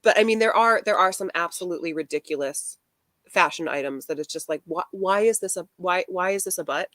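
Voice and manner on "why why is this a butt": ordinary delivery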